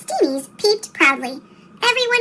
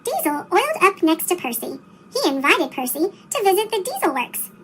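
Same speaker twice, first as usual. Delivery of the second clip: plain reading voice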